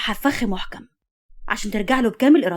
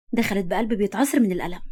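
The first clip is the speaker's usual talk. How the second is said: ordinary delivery, nothing marked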